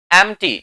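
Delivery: "एम्प्टी" as em-tee; silent p. Shown as a demonstration. Teacher answering own quiz question, p left silent. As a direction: keep the p out of "एम्प्टी". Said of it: "अब भी क्या बोला जाएगा".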